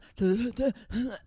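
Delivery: quick laugh. Real, not acted. Laugh after imitation.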